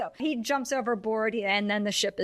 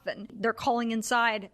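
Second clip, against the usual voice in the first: normal talking voice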